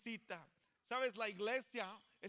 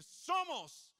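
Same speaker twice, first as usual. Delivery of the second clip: higher, louder call of the word Somos; 8 semitones higher, 7 dB louder than usual. Shown as a demonstration. Pastor leads the congregation's call-and-response, calling out. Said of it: "yo voy a decir".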